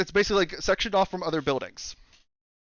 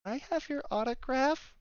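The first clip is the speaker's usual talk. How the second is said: high, soft, shy request; small-voiced kid timidly asking; sheepish kid; 9 semitones higher, 9 dB quieter than usual. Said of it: "sheepishly holding a piece of paper".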